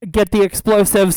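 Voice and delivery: barked order. Pretend. Player orders the court about the bomb, loud barked command. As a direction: bark it out forcefully.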